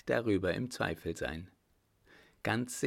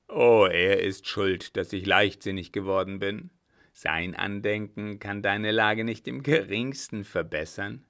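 bitter anger; 8 dB louder than usual. Acted. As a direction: spit out the blame. Brother bitterly blames father.

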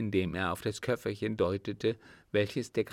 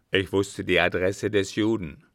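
lightly voiced, louder captain; firm, louder explanation; explaining captain; 8 dB louder than usual. A narrator's reading. Captain tells the count how he found him, matter-of-fact.

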